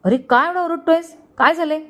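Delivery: high-pitched sisterly scolding; wide-eyed scolding. A narrator's reading. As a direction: snap back at a noisy brother, pitched high. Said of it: "Suman डोळे मोठे करत बोलली".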